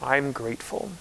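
soft, hushed quote with the stress on I'm; a gentle, hushed I'm grateful, rising at the end; quiet grateful reply. Retold or imitated. Quoting a church member's answer to how she is, hushed. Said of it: "I always get the same response".

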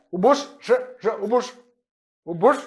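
"ou bouche" with a clipped short ou vowel; short vowel demo. Shown as a demonstration. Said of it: "voyelle courte". ("ou bouche" avec une voyelle courte)

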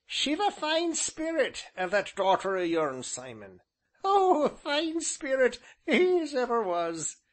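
high, amused village elder; chuckling old man. A narrator's reading. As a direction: crow it in a gleeful old countryman's voice. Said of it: "chuckled the ancient".

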